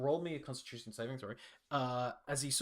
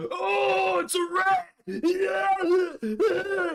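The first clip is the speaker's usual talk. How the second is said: high, loud, terrified screams at a rat; panicked shriek; 10 dB louder, much slower than usual. Acted. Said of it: "As he starts panicking and freaking out".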